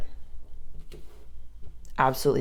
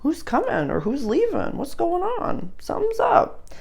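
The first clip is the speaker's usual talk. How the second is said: much higher, playful questioning voice; curious suspicion; 13 semitones higher than usual. Acted. Astrologer voicing the sign wondering who's coming or leaving, high-pitched suspicion. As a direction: pitch it way up, sniffing something out.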